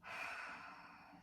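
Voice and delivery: long, soft breathy exhale; big sigh. Shown as a demonstration. Therapist showing the breath out through the mouth, big sigh. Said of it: "Almost like a big sigh".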